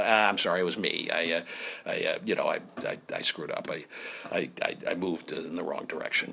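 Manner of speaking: low, quiet, halting apology; retold mumbled, stammering admission; shamefaced confession. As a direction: own up meekly, voice sunk. Acting his own shamefaced confession.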